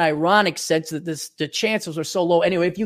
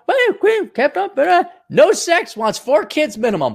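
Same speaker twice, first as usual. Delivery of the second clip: high, mincing voice reading off her demands; mock a dating profile in a high girly voice; mocking girl's voice; 11 semitones higher than usual. Vlogger reading a woman's demands, high and mocking.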